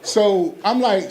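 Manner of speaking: normal talking voice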